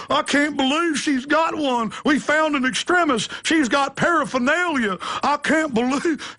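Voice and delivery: hammed-up high, breathless alarm; mock a cop panicking over a find; hyperventilating panic. Police officer reporting an extremist, mock hyperventilating panic. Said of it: "start hyperventilating on video going".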